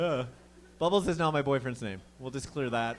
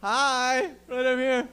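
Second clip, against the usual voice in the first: higher, sing-song cheeky wave; 9 semitones higher, 5 dB louder, slower than usual. Retold or imitated. Answering the yelling driver, cheeky sing-song reply. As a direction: taunt him sweetly.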